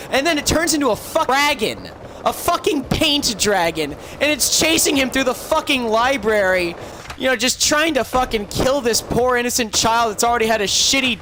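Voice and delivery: high, agitated telling, easing off at the end; worked-up storytelling. Real, not acted. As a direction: build the chase up in a heated rush of disbelief. Builds up the story's frantic chase climax.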